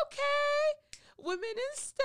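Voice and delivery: very high, loud cheering; hyped-up cheer. Acted. Hyping her on hearing I.T.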